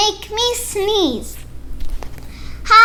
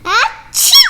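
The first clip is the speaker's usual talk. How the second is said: loud high pretend achoo; a big comic sneeze; acted sneeze; 6 semitones higher, 8 dB louder than usual. Gerald sneezes again.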